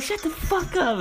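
very high-pitched exasperation; retold snapping back. Arguing with girlfriend, high exasperated retort. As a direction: shoot back in a squeaky-high voice.

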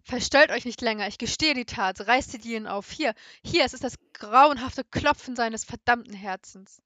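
loud, frantic shouted confession, pitch rising on hier; screamed confession. A narrator's reading. Murderer confessing to the policemen, loud frantic shout. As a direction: crack open and yell it all out. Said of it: "schrie ich hinaus".

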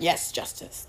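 breathy mock whisper of a gleeful troll. Mocking a gleeful commenter, in a sneering whisper.

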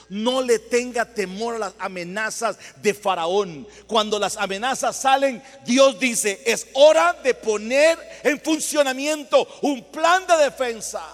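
loud, building sermon cry; vurig preken. Real, not acted. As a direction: proclaim it to the congregation at full force. Preaching at full force.

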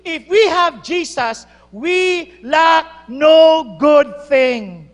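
slow, loud, drawn-out proclamation; loud slow preaching climax; preaching emphasis. Real, not acted. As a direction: proclaim it to the congregation.

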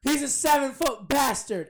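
loud, high, drawn-out announcement; drunk heckler's shout. Retold or imitated. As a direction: holler it slowly to the whole crowd. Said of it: "he was at a show and he got drunk".

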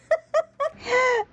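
a real, high-pitched laugh; high burst of laughter. Real, not acted. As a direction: laugh out loud at the memory. Laughing at the memory. (carcajada aguda y sincera)

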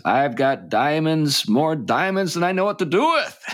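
a laughing hint of a gangster; smug underworld figure. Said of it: "he just kinda says".

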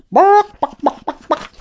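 high yappy dog barks; dog barking. Acted. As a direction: bark like a small dog. Hypnotized hero answers Grandma.

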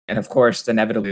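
ordinary talk

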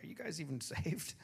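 ordinary delivery

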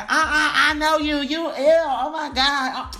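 put-on very high, loud girlfriend squeal; gossiping girlfriends. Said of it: "They're like".

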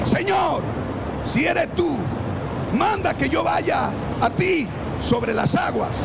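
high, loud shout; shouting plea. Acted. Peter shouts to Jesus from the boat. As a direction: yell it across the water.

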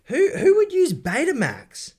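loud, high disbelieving outcry; incredulous. Real, not acted. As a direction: scoff at it loudly. Incredulous outburst.

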